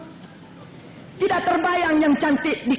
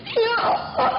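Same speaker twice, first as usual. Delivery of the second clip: high, wailing acted sob; hammed-up tearful cry to God; mock crying; 8 semitones higher, slower than usual. Aminah crying during prayer, mock wailing. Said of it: "Nangis Siti Aminah".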